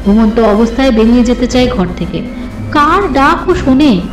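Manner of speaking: ordinary delivery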